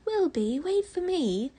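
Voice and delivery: 高声喊叫的小孩声音; high, loud child calling his brother. A narrator's reading. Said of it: "the first voice called again".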